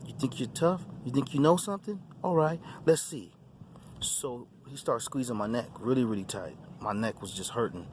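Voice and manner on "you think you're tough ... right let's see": higher, sneering challenge from the demon leader; mocking demon taunt. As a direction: taunt him like a bully daring a fight.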